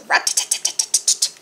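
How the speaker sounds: fast, voiceless tapping syllables; woodpecker pecking. A narrator's reading. Narrator imitates woodpecker at the tree, rapid pecking. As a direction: rattle it off like a pecking beak. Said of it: "The woodpecker pecked".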